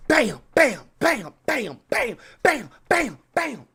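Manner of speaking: high, falling "bam" hits; mimed smashing noises. Pretend. Streamer pretends to smash animators' hands, punchy impact noises. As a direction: punch out each bam like a blow.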